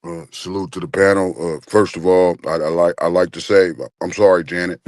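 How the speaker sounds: a tall man's deep apology; deep apologetic voice. Retold or imitated. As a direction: drop the voice way down and apologize.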